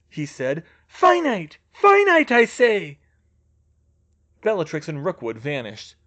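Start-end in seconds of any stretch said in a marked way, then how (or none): 0.9-3.0 high, loud shouted spell, rising on the repeat; frantic villain incantation; desperate command. Acted. Villain desperately casting counter spell.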